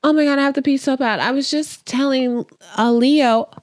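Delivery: high, rushed, oblivious chatter; fake-casual slip. Woman walking in pretending to let a name slip, fake-casual. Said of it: "she's acting like, oh".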